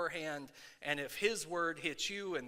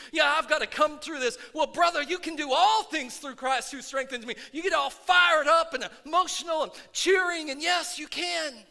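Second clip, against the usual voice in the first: louder, rising preacher's fervor; preaching at full force; 10 semitones higher, 11 dB louder than usual. Acted. Pastor firing up his church, preaching at full force. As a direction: stir the congregation up, preaching hard.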